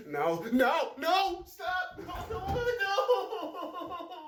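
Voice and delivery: put-on a child begging someone to stop; panicked refusal. Child resisting being taken, panicked.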